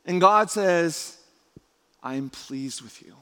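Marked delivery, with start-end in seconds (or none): 2.0-3.2 hushed approval. Acted. God to Jesus before any achievement, hushed approval. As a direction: say it quietly, letting it land. God's words after the loud list.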